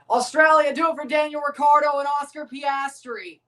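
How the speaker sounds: loud, high cheer for the team; rally the Aussies on, full voice. Real, not acted. Cheering on Australia again.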